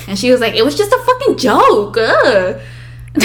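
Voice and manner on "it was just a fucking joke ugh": high, loud exasperated imitation; protesting sister. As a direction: whine back like an annoyed sister. Sister protests during the quarrel.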